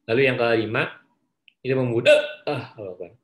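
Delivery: talking through hiccups; words broken by real hiccups; hiccuping. Real, not acted. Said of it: "saya tiba-tiba cegukan".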